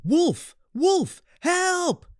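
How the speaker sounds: high, loud fake cry of alarm; shouting for help. A narrator's reading. Shepherd boy pranking villagers, high loud shout for help. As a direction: yell it out to fool the village. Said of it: "he cried out once more".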